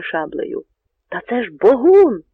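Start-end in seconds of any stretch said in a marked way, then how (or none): 1.1-2.2 loud, raised shout of recognition; astonished cry. A narrator's reading. Polish rider suddenly recognises Bohun, astonished cry. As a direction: cry out in amazement, climbing high on the name. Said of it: "розлігся здивований крик".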